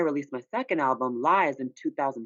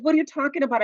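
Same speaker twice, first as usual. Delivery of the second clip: high, loud confused outburst; what are you talking about, high and loud; startled; 12 semitones higher, 4 dB louder, faster than usual. Retold or imitated. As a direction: blurt it out, baffled and teary. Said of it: "I said".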